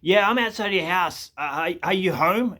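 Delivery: mock tradesman on the line; imitated phone caller. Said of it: "he rings me up and goes".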